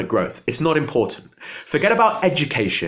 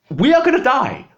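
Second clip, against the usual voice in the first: a high, loud, desperate outburst; despairing cry; 11 semitones higher, 4 dB louder than usual. Real, not acted. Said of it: "That's why I'm sat here in despair".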